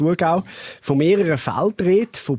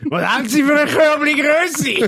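put-on town drunk; 10 semitones higher, 3 dB louder, slower than usual. Acting drunk at checkout, laughing.

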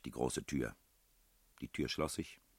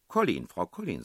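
proud, raised announcement; 5 semitones higher, 9 dB louder than usual. A narrator's reading. Narrator supplies the mother's name, proudly emphatic. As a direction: show off the answer proudly. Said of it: "sagte ich sehr stolz".

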